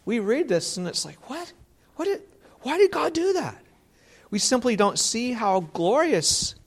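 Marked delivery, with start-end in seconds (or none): 1.3-3.6 sudden high, incredulous what; mock the shocked modern reader, pitch leaping up; baffled outrage. Preacher mimicking modern readers shocked at God's judgment, high and incredulous.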